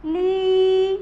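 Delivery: ली with a loud held ee; long stressed ee. Shown as a demonstration. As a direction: stretch the ee in ली. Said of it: "लंबा sound लेते हुए". (ली को लंबा और जोर से)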